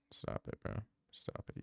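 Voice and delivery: hushed "stop it, bro"; whisper. Real, not acted. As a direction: whisper it, embarrassed. Embarrassed, shushing the chat.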